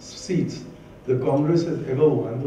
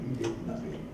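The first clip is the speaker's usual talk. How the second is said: quiet; 12 dB quieter than usual. Real, not acted. Repeated condemnation, dropped low.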